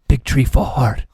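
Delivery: very loud, slow movie-quote boom; booming taunt. Retold or imitated. Player taunting a fallen opponent, slow booming shout. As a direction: boom it out slowly, word by word.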